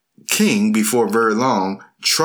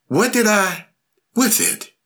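rising confused question; 11 semitones higher than usual. Acted. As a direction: pitch climbs high, ends voiceless. Confused king's voice fails.

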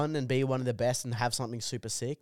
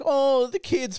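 much higher and louder pitying voice; mocking whine; 13 semitones higher, 6 dB louder, slower than usual. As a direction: parody a sappy sigh. Mocking friend's sympathy.

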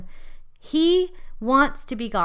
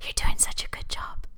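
soft whispered reassurance; whispering; 4 dB quieter than usual. Retold or imitated. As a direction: whisper it like God's voice in your ear. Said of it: "all I heard was God's voice saying".

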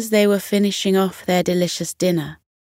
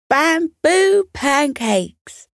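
high, slow, delighted call; joyful call; 9 semitones higher, much slower than usual. A narrator's reading. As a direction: call it out with pure joy. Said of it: "he called out in joy".

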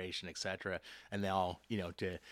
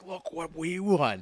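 lightly voiced proud child, much higher, slow; proud kid; 9 semitones higher, 6 dB louder, much slower than usual. Coach voicing a kid proudly showing the ring at show and tell. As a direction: play an excited kid showing off. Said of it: "show and tell".

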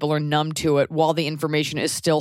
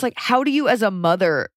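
usual speaking voice

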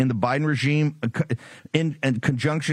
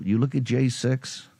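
ordinary talk

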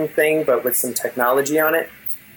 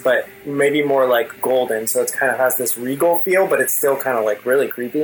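ordinary talk

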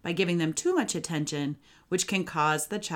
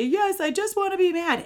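put-on child's voice; 13 semitones higher, 5 dB louder than usual. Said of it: "sometimes they'll say".

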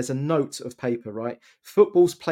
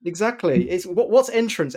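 ordinary talk, nothing marked

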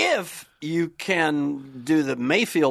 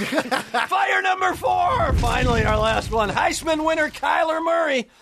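high, slow announcer voice; announcer shout; 10 semitones higher, slower than usual. Acted. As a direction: announce it like a host.

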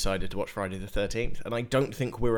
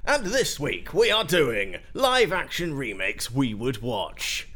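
announcer voice; 4 semitones higher, 6 dB louder, slower than usual. Acted. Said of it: "I don't know what voice that is".

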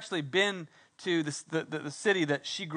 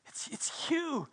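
stunned realization; 9 semitones higher, 8 dB quieter, slower than usual. Acted. Mary recognizes Jesus in awe.

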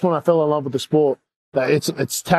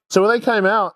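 ordinary delivery

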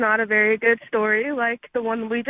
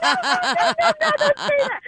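much higher alarmed no-no; shocked mom; 13 semitones higher than usual. Retold or imitated. Mom stopping daughter from swearing, high startled scold. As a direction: scold the toddler in a startled squeal.

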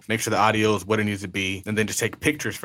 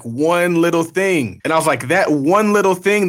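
rising, louder, slower indignation; exasperated; 6 semitones higher, 6 dB louder than usual. Retold or imitated. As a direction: build to outrage. Retelling frustration, building outrage.

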